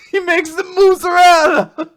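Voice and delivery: high, loud cartoon Italian; mock Italian accent. Italian would-be Beethoven explains himself, loud mock accent. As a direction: shout it in a hammed-up Italian accent. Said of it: "but he's, he's Italian".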